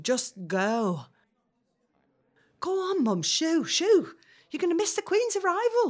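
high, loud, shooing mum out; shooing insistently. Acted. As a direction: push her out the door brightly. Shooing mum out, much higher, louder.

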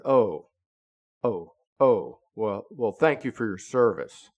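a hint of a flustered man; flustered backpedal. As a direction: stumble over the words, embarrassed. Rick caught off guard.

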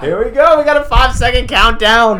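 high, loud coach countdown. Real, not acted. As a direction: shout out the finish.

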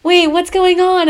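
put-on high, loud, drawn-out bewildered kid; kid Junior. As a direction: pipe up slowly, high and confused. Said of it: "and then the kid, Junior, goes".

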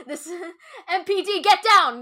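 barking police order; stern cop shouting commands; commanding officer voice. Retold or imitated. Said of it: "the strong, commanding voice of an officer".

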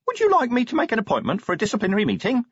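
high, quick, eager imp voice; hopeful imp. A narrator's reading. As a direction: offer it hopefully as a little imp. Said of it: "said the imp, hopefully".

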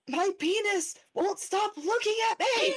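put-on high, distressed child's voice; a toddler sharing his big worry; little boy. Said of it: "And he's like".

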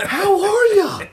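squeaky high, slow greeting; silly high voice. Acted. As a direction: greet in a giddy falsetto. Answers friend's greeting after sip.

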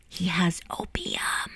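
hushed, drawn-out whisper; slow stage whisper; conspiratorial whisper. Acted. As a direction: whisper it slowly, like a secret. Secretive reveal about opium to friend.

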